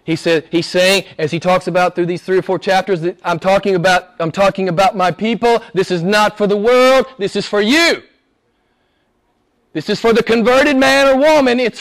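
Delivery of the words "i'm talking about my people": ordinary delivery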